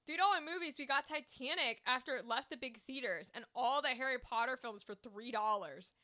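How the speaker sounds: ordinary delivery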